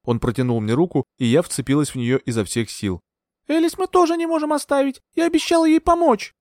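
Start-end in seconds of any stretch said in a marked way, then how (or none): 3.5-6.3 lightly voiced young woman, pleading; earnest heroine begging to help a friend. A narrator's reading. (героиня с мольбой)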